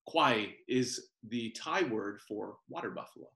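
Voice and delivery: usual speaking voice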